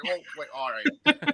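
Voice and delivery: retold realization. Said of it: "You're like".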